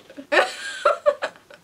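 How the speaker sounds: high, loud burst of real laughter; helpless laugh. Real, not acted. Prankster reacting to sister's text, helpless laugh. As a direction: crack up completely.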